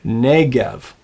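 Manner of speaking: Negev modelled with NEH stressed; stress on first syllable. Shown as a demonstration. Teacher asking students to repeat, Negev stressed on first syllable. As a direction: lean into the opening syllable of Negev. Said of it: "Say that after me".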